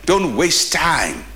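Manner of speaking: slow, weighty stress on waste; slow emphasis. Acted. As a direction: drag out don't waste time for weight. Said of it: "When God gives you an assignment".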